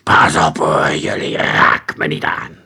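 low, loud, menacing hiss; angry hiss. A narrator's reading. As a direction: hiss a slow threat at them. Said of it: "siste met een boze tong". (dreigend, boos gesis)

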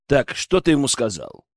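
ordinary delivery

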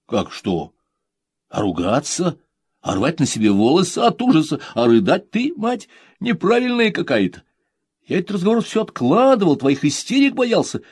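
high, loud teasing son; grown son. Acted. Son teasing his mother, high and cheeky. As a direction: needle mother for not panicking.

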